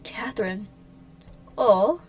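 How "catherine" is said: breathy, sinister Catherine; whispered name. Acted.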